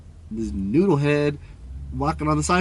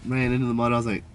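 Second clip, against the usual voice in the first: normal talking voice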